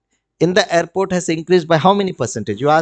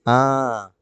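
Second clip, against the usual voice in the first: usual speaking voice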